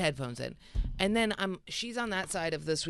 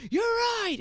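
very high, loud mock cry; yelled concession; 5 dB louder than usual. Woman conceding to boyfriend mid-fight, high retold yell. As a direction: give in while still yelling, hammed up.

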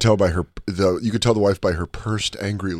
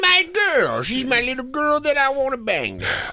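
creepy high voice; 13 semitones higher than usual. Retold or imitated. Mocking creepy dad.

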